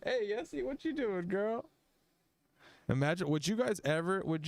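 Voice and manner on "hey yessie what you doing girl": much higher put-on Snoop voice; [name] impression. Retelling Snoop on stage, much higher Snoop voice. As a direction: play Snoop sassing the crowd. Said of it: "He's like".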